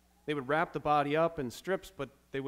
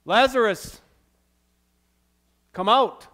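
commanding call, much louder and higher; Jesus calls Lazarus out of the tomb; loud shout; 6 semitones higher, 12 dB louder than usual. Acted. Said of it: "he shouted with a loud voice".

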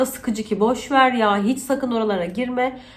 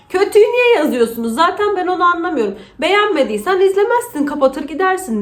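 higher, louder, faster complaint; mock rant; 8 semitones higher, 5 dB louder, faster than usual. Real, not acted. Vlogger rants at viewers leaving bad comments, half-joking. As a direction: vent about bad comments.

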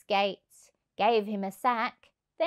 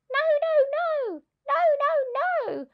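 much higher and louder, alarmed cries of no; animals protesting; 4 dB louder than usual. A narrator's reading. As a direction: cry out like a crowd of frightened animals. Animals cry out together.

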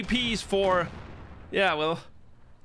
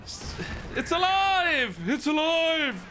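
excited exclamation; 7 semitones higher, slower than usual. Real, not acted. Excited exclamation, Frankenstein quote.

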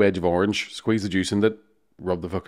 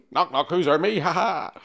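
put-on drunk joke; 7 semitones higher, slower than usual. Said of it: "drunk joke retold".

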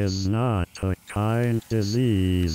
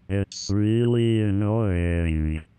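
ordinary talk, nothing marked

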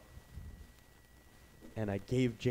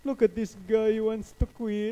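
high, loud mocking dad voice; dad scoffing at his quitting son, high and loud; 11 semitones higher, 8 dB louder than usual. Dad mocking his son to a coworker, high loud mockery.

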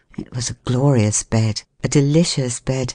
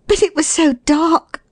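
very high, plaintive excuse; apologetic; 14 semitones higher than usual. A narrator's reading. Woman excusing her mistake to the man, apologetic. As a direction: offer the excuse meekly, pitched right up. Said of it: "I said apologetically".

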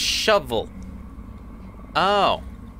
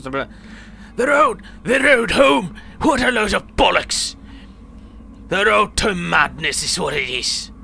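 put-on old man's outburst, higher and louder at first, then settling; acted indignant rant in a put-on accent; 5 semitones higher, 3 dB louder than usual. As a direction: scoff loudly as a cantankerous old villager.

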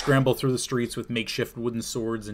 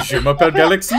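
loud, high mock French through laughter; cracking up in a silly French voice; laughing mock French; 6 semitones higher, 13 dB louder, faster than usual. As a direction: ham up a cartoon French accent. Mock dog speaking French.

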